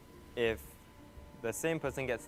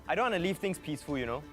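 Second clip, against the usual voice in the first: excited; 7 semitones higher, 3 dB louder than usual. Real, not acted. Pushing the group to vote.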